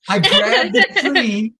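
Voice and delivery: slow, laughing through the words. Real, not acted. Dad laughing while telling friends about grabbing the tree, laughing. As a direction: laugh it out slowly as you tell it.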